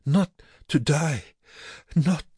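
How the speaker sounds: desperate plea. A narrator's reading. Sick prisoner pleading not to die, desperate high voice. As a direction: beg in short separate bursts.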